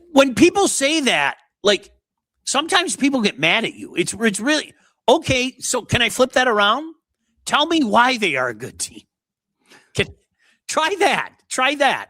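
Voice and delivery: high-pitched, incredulous pleading; worked-up, squeaky exasperation; exasperated challenge. Real, not acted.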